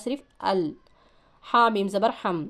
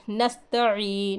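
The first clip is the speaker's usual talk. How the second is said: normal talking voice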